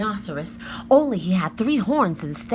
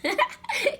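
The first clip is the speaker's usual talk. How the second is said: giggle. A narrator's reading. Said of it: "Annie laughed".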